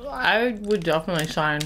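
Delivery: talking while chewing; words muffled by food; mouth full of food. Real, not acted. Seen 3: Unsure who speaks; man bites bar mid-phrase, woman chewing too.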